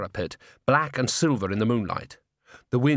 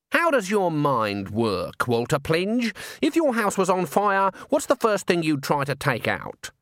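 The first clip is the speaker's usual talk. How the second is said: put-on slow opening, lightly raised old witch voice; probing Granny; 6 semitones higher than usual. A narrator's reading. Granny testing how Walter's mind works, probing. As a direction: question him deliberately, lightly in character.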